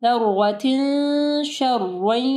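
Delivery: ज़र्रतिन शर्रन slow, long nasal hum before sh; ज़र्रतिन शर्रन drawn out with hidden, hummed n; ikhfa ghunnah. Shown as a demonstration. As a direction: hold the final n of ज़र्रतिन in the nose into शर्रन. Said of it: "तो इख्फा गुन्ना होगा".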